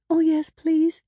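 put-on high, loud, delighted child; excited, high-pitched acceptance; eager little girl. A narrator's reading.

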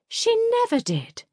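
squealed high and loud; scandalised delight; shocked gasp. Acted.

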